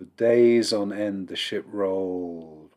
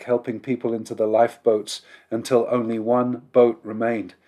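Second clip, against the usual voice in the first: usual speaking voice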